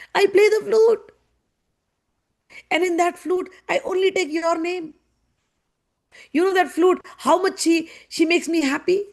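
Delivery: high, emotional acted appeal; hammed-up lover's lament; tearful pleading. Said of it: "Krishna is in tears".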